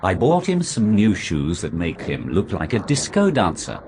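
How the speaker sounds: usual speaking voice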